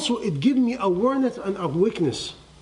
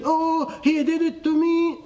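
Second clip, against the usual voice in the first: higher, whiny blaming voice; mock complaint; 9 semitones higher, slower than usual. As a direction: play the aggrieved victim, pitched up. Mock complaint she did not make.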